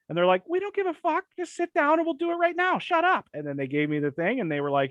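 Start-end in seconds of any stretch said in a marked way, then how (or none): 0.5-3.3 very high, careless mocking voice; mock clinic staff. Vax story, staff brushing off his appointment, very high mock voice. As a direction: squeak it out like a bored receptionist.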